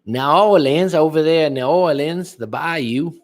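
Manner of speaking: lightly put-on Louisiana Cajun accent, just fooling around; bad New Orleans accent. Streamer playing around for chat with a rough New Orleans accent. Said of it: "That was probably a bad accent".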